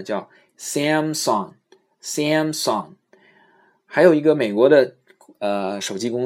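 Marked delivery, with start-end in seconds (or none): 0.6-2.9 very slow, careful Samsung said twice; English Samsung. Shown as a demonstration. Teacher showing the English Samsung slowly. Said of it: "三星英语怎么发呢".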